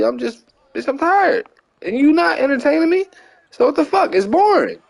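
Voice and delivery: high-pitched retold whine of complaint; exasperated complaint. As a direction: act out being fed up, pitch jumping way up. Retells his complaint in the theater.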